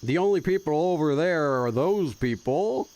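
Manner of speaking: hammed-up Texan twang; bad fake Texas accent. Streamer failing at a Texas impression, fake accent. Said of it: "a bad impersonation of a Texas person".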